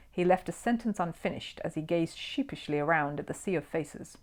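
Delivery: ordinary delivery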